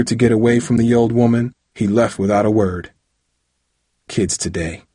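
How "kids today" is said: low, softer grumble; grumbling. A narrator's reading. Old woman to herself as the boy walks away, grumbling. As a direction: grumble it under your breath. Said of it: "she grumbled".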